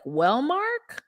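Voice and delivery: high-pitched, hammed-up scolding; acted high-voiced reprimand; mock telling-off. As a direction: pitch it way up as you scold him.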